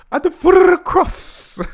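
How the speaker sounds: very high, loud rasping shout; raspy rapper impression. Repeating the rapper's line in amusement, raspy shouted mimicry. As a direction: push out the rapper's raspy yell. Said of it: "The way he said that".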